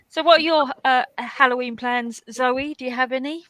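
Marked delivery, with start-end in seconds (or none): none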